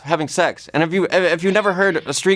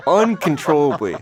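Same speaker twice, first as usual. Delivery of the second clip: ordinary talk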